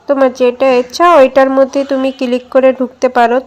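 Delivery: ordinary delivery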